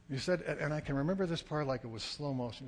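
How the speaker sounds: ordinary delivery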